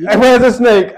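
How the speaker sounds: alarmed, hunting for the hidden snake; panicked. Retold or imitated. On the trailer with everyone panicking, a panicked shout. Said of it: "I'm like".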